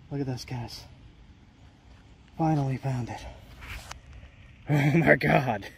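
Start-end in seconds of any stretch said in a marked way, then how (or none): 2.4-5.7 excited. Real, not acted. Finds the long-sought rock.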